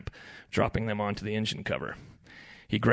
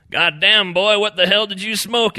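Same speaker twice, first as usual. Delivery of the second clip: loud, raised, incredulous teasing; amused ribbing; 11 semitones higher, 11 dB louder than usual. A narrator's reading. Fishing buddy teases spaced-out Ed, loud ribbing. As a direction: razz him loudly. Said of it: "asked Donnie with a chuckle".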